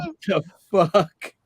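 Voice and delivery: slow, high, stunned disbelief; shocked what. Retold or imitated. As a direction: drag it out in shock. Old woman propositions him at night.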